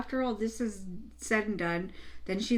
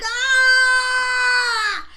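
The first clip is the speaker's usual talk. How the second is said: long, high, full-volume "aah" shriek; imitated scream; 14 semitones higher, 10 dB louder than usual. Showing the neighbour screaming again, mock scream. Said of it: "and then she starts screaming again".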